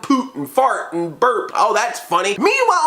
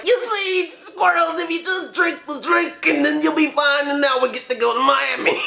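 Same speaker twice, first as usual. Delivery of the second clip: hammed-up high sly bully; mock bully voice; 7 semitones higher than usual. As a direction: play the smug kid bully. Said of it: "He is acting like".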